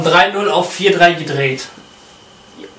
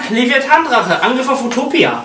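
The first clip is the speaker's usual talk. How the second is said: card duel hero; 6 semitones higher than usual. Acted. Dramatic card duel attack call.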